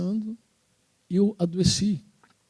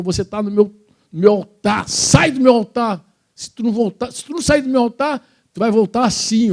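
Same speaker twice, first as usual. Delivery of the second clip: higher, louder demonic threat; menacing demon; 4 semitones higher, 5 dB louder than usual. Retold or imitated. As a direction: snarl it like a demon guarding its altar. Demon creature threatens him.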